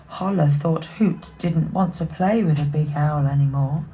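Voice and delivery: ordinary delivery, nothing marked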